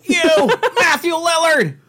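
high Shaggy voice, half laughing. Pretend. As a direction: shout it in a high cartoon voice. Continues Shaggy impression, laughing.

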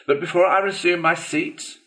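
high-pitched after-dinner orator; high, pompous banquet speech; speechmaking weasel. A narrator's reading. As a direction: make a toast in a high weasel voice.

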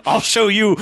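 high, loud taunting voice; villain taunt. Retold or imitated. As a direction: shout it defiantly, high. Villain quoted, mouth full.

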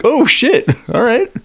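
high, startled "oh shit"; shocked high squeal at the blast; shocked yelp. Retold or imitated. Stepping on a landmine, shocked reaction.

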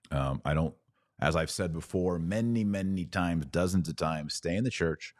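normal talking voice

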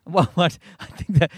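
ordinary talk, nothing marked

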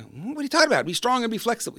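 high, incredulous scoff; mock disbelief. Coach voicing a doubting listener's high mock disbelief. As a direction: play the sceptic who thinks it's nonsense. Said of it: "I know that sounds like".

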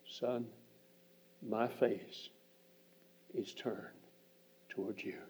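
soft and slow, dropping almost to a breath; God speaking softly and tenderly to a son; hushed tender voice. Retold or imitated. God's words, hushed and tender.

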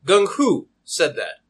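slow, doubtful repeat with a falling end; suspicious. Acted. Customer still suspicious of the deal, slow and doubting. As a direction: draw it out, weighing each word.

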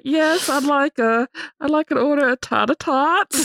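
high laughing drive-thru order. Retold or imitated. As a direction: order tots while giggling. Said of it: "I was like".

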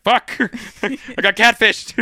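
cracking up while voicing the dismayed hero; laughing. Aquaman realizing he was tricked, laughing. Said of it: "And he's like".

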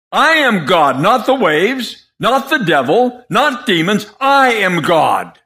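high, forceful preacher's scolding as Jesus; exasperated rebuke. Acted. Jesus to sinking Peter who doubts him, exasperated rebuke. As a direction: rebuke him hard, drawing out the key words.

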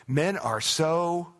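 high, slow, exasperated mock whine; mock complaining. Preacher imitating wives griping about men, mock exasperated. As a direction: drag it out like a fed-up gossip. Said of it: "Okay, what do you say?".

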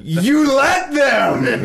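very high, drawn-out wrestling promo yell; mock promo yell. Drunk friend berating a rival, stretched high promo yell. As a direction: imitate a drunk wrestler, stretching every word on one inflection. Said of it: "yelling, and he had, like, he had one inflection".

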